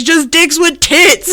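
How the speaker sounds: breaking into laughter on the words; laughing. Real, not acted. Host quoting Ted to friends, cracking up.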